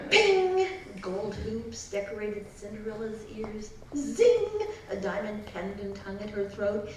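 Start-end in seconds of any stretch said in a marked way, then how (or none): 0.1-0.7 bright ping. A narrator's reading. Sound effect word. 3.9-4.5 bright zing. A narrator's reading. Sound effect word.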